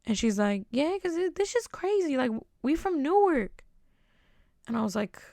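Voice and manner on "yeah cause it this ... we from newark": high-pitched imitation of the girl; mock high Newark girl voice; girl's high voice. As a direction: pitch way up to play her. Girl voiced in retold story.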